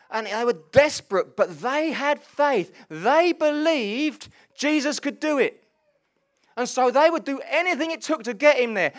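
loud, high, driving preacher's call; rally the congregation with rising fervour; fervent sermon climax, slowing on key lines; preaching at full force. Acted. Preaching climax about desperate faith.

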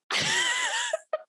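high shrieking burst of laughter; real screaming laugh. Real, not acted. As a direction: cackle up high. Laughing at own story.

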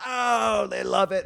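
high, mild mock cheer; delighted hecklers. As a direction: lightly imitate a drunk table cheering. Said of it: "They're like".

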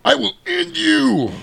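high, slow mock threat; yelling dad. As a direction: threaten him, each word stretched high. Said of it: "and then yells at Rudolph".

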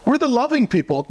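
high, sing-song mock of the apostates; mocking quote. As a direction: sneer their claim back at them. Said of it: "people that are like".

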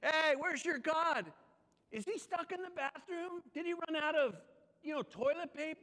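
high-pitched, taunting call; Elijah heckling. Acted. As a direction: jeer at the rivals from the sidelines. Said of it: "then you got Elijah sitting".

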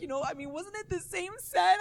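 a high, pleading tone of her younger self; tearful younger self. Retold or imitated. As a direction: squeeze the voice up high, as if close to tears. Retelling her tearful question offstage.

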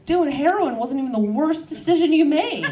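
high, exasperated scolding; mock dismay. Comic to friend who went to Hooters, mock dismay. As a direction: build to comic disbelief.

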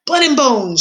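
high, loud rat-crowd chant; shouted. A narrator's reading. Another rat in the crowd, shouting high. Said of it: "shouted another".

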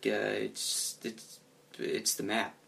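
soft, slow, stammering answer; small, halting, sheepish reply; meek hesitation. Acted. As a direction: answer timidly, faltering on the start. Acted meek answer to angry friend.

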